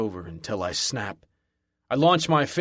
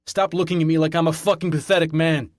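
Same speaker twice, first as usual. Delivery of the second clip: higher and louder, hurt fury; angry shout; 5 semitones higher, 6 dB louder than usual. A narrator's reading. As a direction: shout him down in anger. Raging at staring crowd.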